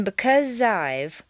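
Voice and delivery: slow "because I've", Z blending into "I've"; "because I've" joined smoothly as one word; linked words. Shown as a demonstration. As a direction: slide the Z of "because" onto "I've". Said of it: "blend together smoothly".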